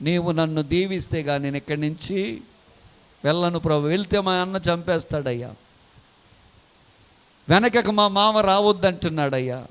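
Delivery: soft, tearful begging; tearful plea. Retold or imitated. Jacob clinging to God's feet, quietly weeping as he begs. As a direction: plead through tears at his feet. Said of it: "కన్నీరు కార్చుచు".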